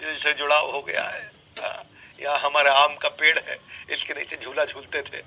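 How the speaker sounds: a touch of wistful reminiscing; mock sentimental. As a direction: lightly act someone fondly attached to the past. Voices a sentimental attachment to old things.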